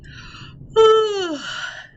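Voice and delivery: loud, high yawn falling away; big yawn. Pretend. Storyteller showing children a sleepy morning stretch, big yawn. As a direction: yawn big and long, gliding down. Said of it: "Big yawn".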